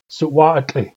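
usual speaking voice

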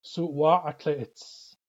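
Suwa'atla'its drawn out very slowly, each syllable separate; slow model pronunciation. Shown as a demonstration. Teacher slowly modelling Suwa'atla'its for learners. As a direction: spell Suwa'atla'its out with the voice. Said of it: "Let's build this one".

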